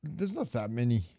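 ordinary talk

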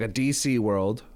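usual speaking voice

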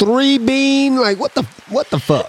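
can't believe it, what the; incredulous outburst; outraged disbelief. Real, not acted. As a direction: pitch shoots up in disbelief. Incredulous rant.

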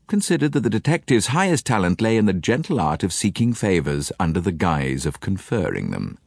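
ordinary delivery, nothing marked

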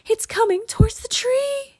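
high, loud panicked warning; urgent high-pitched yell; alarmed cry. A narrator's reading. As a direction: cry out as danger closes in. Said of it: "Annie cried".

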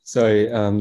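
ordinary delivery

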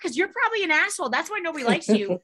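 high, loud, laughing rant; wife scolding her husband, mock harsh and high; nagging wife. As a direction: berate him in a hammed-up shrill voice, laughing. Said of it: "you know what I say".

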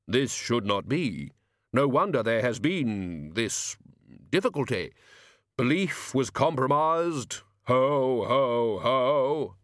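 slow, solemn Death with a tacked-on laugh; indignant Death. Acted. Death objecting to false Hogfathers, indignant. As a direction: pronounce it weightily, drawing out each word.

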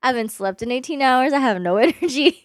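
whine-protest with a laugh near the end; mock complaint. Retells protest at pushing the car.

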